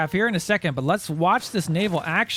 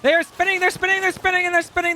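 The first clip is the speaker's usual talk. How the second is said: much higher, louder hyped calling; excited commentary; 11 semitones higher, 4 dB louder than usual. Real, not acted. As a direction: call the spinning ships like a hyped caster. Hyped call of spinning ships.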